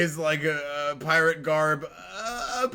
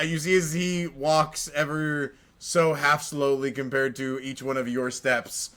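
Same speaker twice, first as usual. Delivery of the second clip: ordinary talk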